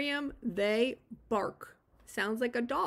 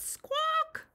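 squawking parrot. Pretend. Reading parrot sound for kids.

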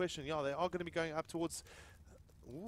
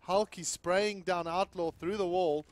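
excited; 3 semitones higher, 7 dB louder, slower than usual. Real, not acted. Big play spotted live.

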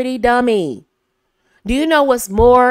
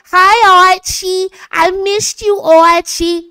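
high, loud, cutesy greeting; sweet-spot voice; 10 semitones higher, 8 dB louder, slower than usual. Retold or imitated. Wife greeting husband Archie, high and cutesy. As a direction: coo his name, much higher and louder. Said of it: "I'll go".

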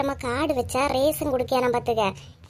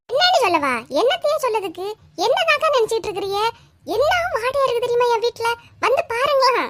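angry complaint; 6 semitones higher than usual. Acted. Neighbours quarrel over smell.

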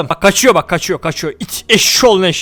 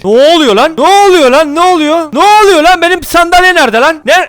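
high, loud alarmed shouts building; panicked yelling; 6 semitones higher, 5 dB louder than usual. Real, not acted. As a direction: yell in disbelief as the chair gets taken. Someone steals his chair, panic. (sandalye çalınırken panikle bağırarak)

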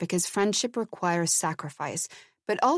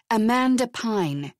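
louder, slow, each word pressed; slow emphatic repeat; 4 semitones higher, 4 dB louder, slower than usual. A narrator's reading. Elizabeth repeating the daughter's name to the producer, slow and louder. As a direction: spell the name out for him. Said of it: "she repeated".

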